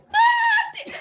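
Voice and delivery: shrieking laughing what; stunned at the price; shocked shout. Retold or imitated. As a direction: scream it in disbelief. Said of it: "I'm like".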